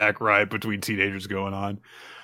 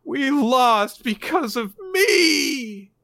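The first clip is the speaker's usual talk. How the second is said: high, slow wailing sob; crying out; 5 dB louder, much slower than usual. Retold or imitated. Girl breaking down in tears to her friend, crying. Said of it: "she starts full on crying".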